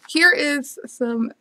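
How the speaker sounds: usual speaking voice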